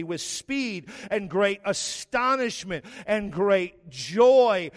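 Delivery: a preacher building toward joy; preaching. Acted. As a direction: drive it home to the congregation. Sermon building to full force.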